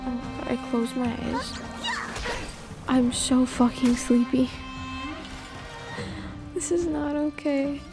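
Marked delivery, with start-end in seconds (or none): none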